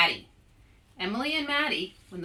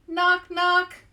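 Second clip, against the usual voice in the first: knock knock; 9 semitones higher, 7 dB louder than usual. Acted. Knock at the clubhouse door.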